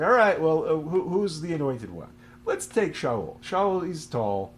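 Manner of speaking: high, loud mock choosing; acted deliberating. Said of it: "So I was like".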